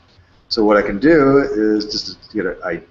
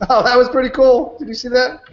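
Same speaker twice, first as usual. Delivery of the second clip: excited; 14 semitones higher, 5 dB louder than usual. Real, not acted. Delighted by bouncing animation.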